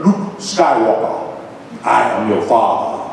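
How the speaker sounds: a hint of a menacing masked father; Darth Vader impression. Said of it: "as the voice of Darth Vader".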